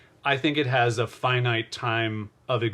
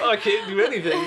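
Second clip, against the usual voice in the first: laughing mock whine; 9 semitones higher, 3 dB louder than usual. Joins the mock lament.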